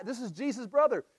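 usual speaking voice

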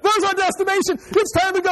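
hammed-up high pilot voice; mock pilot. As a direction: squeak it out like a panicking pilot. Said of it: "it's like".

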